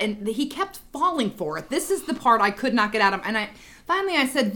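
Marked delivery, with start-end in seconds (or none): none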